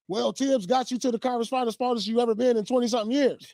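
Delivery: high-pitched retelling of fans' talk; high, animated quote of everybody; imitating the doubters. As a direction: pitch it way up as the skeptical crowd. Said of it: "everybody was like".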